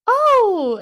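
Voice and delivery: high, loud surprised gasp of Oh; a burst of delighted surprise. Retold or imitated. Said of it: "I was like".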